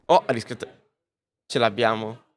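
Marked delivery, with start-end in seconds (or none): none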